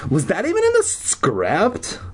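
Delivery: lightly mocking high girly voice; a touch of a mocking generic girl; Every Girl Ever voice. [name] mutters to someone, mildly mocking girly voice. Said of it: "that's the voice I do for Every Girl Ever".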